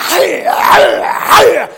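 loud high barking imitation; dogs barking back. Imitating his pit bull and shepherd barking back. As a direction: bark back hard like the big dogs. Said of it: "they're going".

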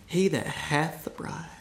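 hushed, savouring the phrase; soft repeat. Shown as a demonstration. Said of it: "Don't you love that?".

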